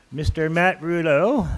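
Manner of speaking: put-on slow, drawn-out French accent on the name. Council chair announcing a delegate, playful French accent. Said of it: "That's my French accent".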